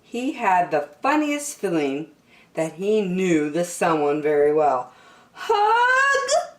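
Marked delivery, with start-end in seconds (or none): 5.3-6.5 high, louder eager question; hope bursting out of a small bear; squeaky hopeful hug. Acted. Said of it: "asked Douglas".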